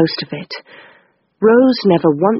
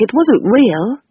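usual speaking voice

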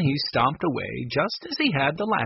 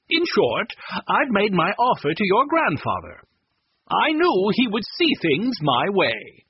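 a lightly raised, self-satisfied gentleman's voice; pompous Mr. Chessy; 7 semitones higher, 3 dB louder than usual. A narrator's reading. Pushy buyer boasting to the children, lightly voiced self-satisfied. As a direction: sound smug about the deal you made.

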